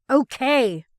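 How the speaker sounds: shouted surrender; loud angry shout. A narrator's reading. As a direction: yell it to end the fight. Ross still yelling, giving in.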